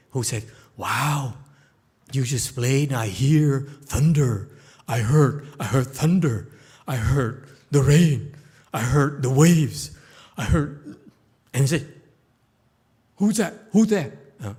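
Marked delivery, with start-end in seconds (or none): 0.8-11.0 loud, awed exclamations; amazed listener. Acted. 13.2-14.1 high, loud startled call; Yu Bo Ya calling toward the rocks, high and startled. Acted. Musician hearing a voice.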